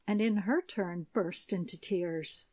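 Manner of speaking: ordinary delivery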